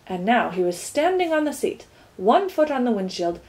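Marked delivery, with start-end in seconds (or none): none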